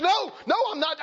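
high-pitched acted protest; mock-defensive squawk of no; indignant denial. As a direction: deny it hotly as the accused.

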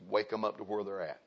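ordinary talk, nothing marked

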